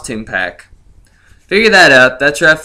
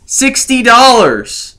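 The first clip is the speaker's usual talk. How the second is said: higher and slowed, hammering the sum; outraged emphasis; 7 semitones higher, slower than usual. Acted. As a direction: repeat the price in mock disbelief. Dramatic price reveal.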